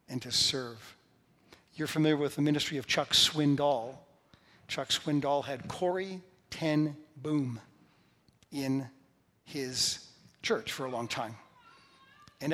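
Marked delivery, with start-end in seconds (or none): none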